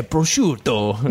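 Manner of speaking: put-on slow hammy Italian accent. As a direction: drawl it like a fake Italian. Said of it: "I always go to Italian".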